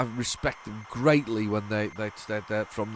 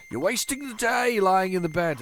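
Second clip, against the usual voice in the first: higher-pitched mock nagging dad; 7 semitones higher, 3 dB louder than usual. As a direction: tease like a dad scolding a lazy teen. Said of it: "a dad thing to say".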